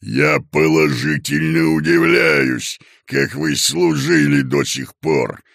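bang it out like a dented pot; clanging pot voice. A narrator's reading. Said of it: "оглушая Короткова кастрюльными звуками".

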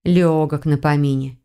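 usual speaking voice

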